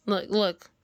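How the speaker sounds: ordinary talk, nothing marked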